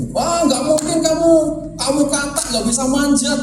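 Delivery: a hint of a heckling crowd; jeering spectators. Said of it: "ini orang berteriak-teriak".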